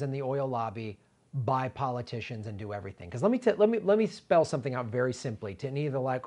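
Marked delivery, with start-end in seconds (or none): none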